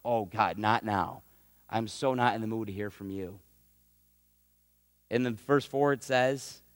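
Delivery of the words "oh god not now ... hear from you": low, quieter fed-up grumble; irritated brush-off. Retold or imitated. Low fed-up grumble as Abram snaps at God. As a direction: brush God off, not in the mood. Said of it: "jumped down God's throat like".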